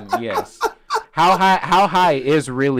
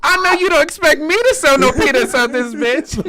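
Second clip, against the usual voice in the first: high, loud comic outrage; Tracy Morgan; 12 semitones higher, 5 dB louder than usual. Acted. Retelling the cornfield scene, high indignant mock shout. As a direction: protest loudly in a raised impersonation voice. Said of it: "He said".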